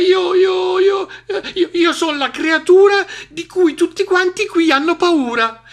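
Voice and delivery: high, loud stammering mouse; stammer, then push on with squeaky bravado; nervous bluffing. Acted. Mouse bluffs the hungry monster, nervously stammering.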